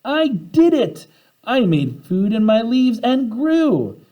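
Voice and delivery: high, slow triumphant shout; the plant shouting its triumph; joyful shout. A narrator's reading. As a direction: cry out in joy at last. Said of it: "Phoebe shouted".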